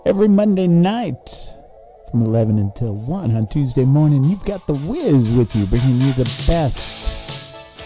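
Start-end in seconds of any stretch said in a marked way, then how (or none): none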